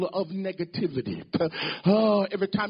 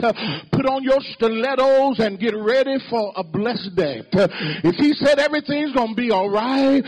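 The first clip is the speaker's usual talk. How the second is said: rising, louder sermon climax, driving each phrase; preaching at full force; 2 semitones higher, 4 dB louder than usual. Acted. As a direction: build the congregation up to a shout.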